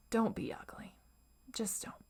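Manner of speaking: hushed, nagging whisper; whispered inner critic. Retold or imitated. Voicing the inner critic telling her not to be ugly, whispered. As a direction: hiss it softly like a warning in your head. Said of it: "saying things ... that sound like".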